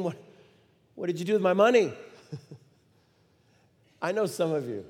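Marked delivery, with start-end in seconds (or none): 0.0-1.9 retold angry demand, a little louder. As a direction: snap it out like a father wanting his money back. Father demanding money back.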